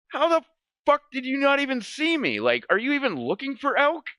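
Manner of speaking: high, disbelieving mock yell at dad; incredulous outburst. Son yelling at dad who never noticed him, incredulous high outburst. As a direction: act stunned he never saw you.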